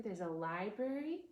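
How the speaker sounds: usual speaking voice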